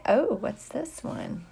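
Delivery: usual speaking voice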